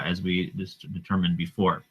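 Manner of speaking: ordinary delivery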